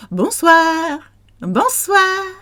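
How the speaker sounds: "Bonsoir" in a high sing-song; high, cheery French "Bonsoir"; light high bonsoir. Shown as a demonstration. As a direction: lift "Bonsoir" way up, held on swahr. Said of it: "You can go".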